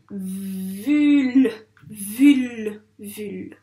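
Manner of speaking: VUL stretched sound by sound, then shorter; blended syllable. Shown as a demonstration. Teacher joining three sounds into one syllable, stretched VUL. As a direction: draw out v, u and l in VUL. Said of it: "elle fait quel son".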